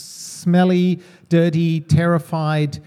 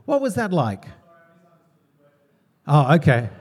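ordinary delivery

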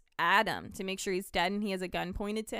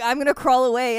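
higher, louder put-on voice; mock doctor; 4 semitones higher, 10 dB louder than usual. Doctor announcing he'll crawl away, mock voice. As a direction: play the doctor leaving, hammed-up. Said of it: "He's like".

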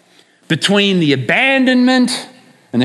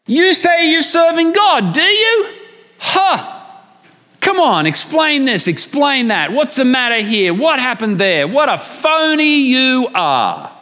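high jeering taunts, much higher than usual; mocking voice; 4 semitones higher than usual. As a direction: needle him like the scoffer in your head. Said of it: "You know, it's mocking".

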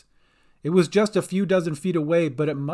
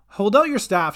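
higher, louder instruction; urgent instruction; 6 semitones higher, 6 dB louder than usual. A narrator's reading. Sister Cat reaches over boat's side, urgent call. Calling to swimmer from boat.

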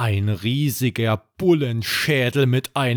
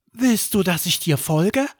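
lightly voiced young boy, higher; a hint of a curious child asking; Erik asking; 7 semitones higher than usual. A narrator's reading. Erik asks the squirrel through the window, lightly childlike. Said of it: "sagt er".

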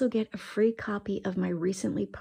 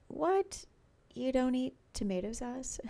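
mock incredulous, much higher pitch; imitating surprise; 6 semitones higher than usual. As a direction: play the listener's shocked disbelief. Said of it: "I know you're thinking".

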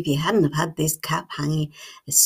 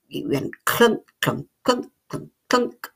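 mechanical clunk clunk sounds; clunking cog noises; 6 semitones higher than usual. A narrator's reading. Imitating the cogs whirring.

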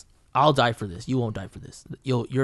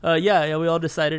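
higher, stammering mock admission; nervous; 5 semitones higher, 4 dB louder than usual. Studio mates owning up to the angry painter, nervous. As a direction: imitate caught-out people fumbling an answer. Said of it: "we're all nervous".

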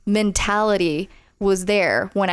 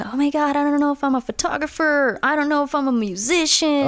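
high, fast, flustered self-doubt; mock panic, voice jumping up; panicked inner voice; 6 semitones higher than usual. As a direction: blurt it out in a panic. Retelling own panicked self-talk.

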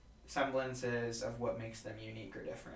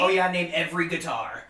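loud, higher mock quiz demand; mock challenge; 5 semitones higher, 10 dB louder than usual. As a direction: challenge like a bar know-it-all. Quizzed by bar know-it-alls.

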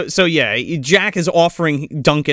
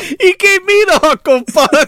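much higher, louder wiseguy squawk; 6 dB louder than usual. Retold or imitated. Quoting the [name] prank bit in a high loud squawk.

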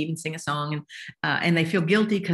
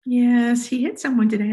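weary mother; 6 semitones higher, 6 dB louder than usual. Retold or imitated. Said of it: "his mother called me ... and said".

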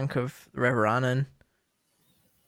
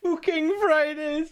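squeaky high voice; 14 semitones higher, slower than usual. Pretend. Repeats title in silly voice.

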